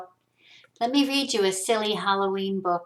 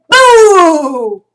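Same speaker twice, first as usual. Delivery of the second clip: loud, long, high boo sliding down; ghost's scary boo; 8 semitones higher, 16 dB louder than usual. Acted. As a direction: try to scare with a drawn-out wail. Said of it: "to try to scare him".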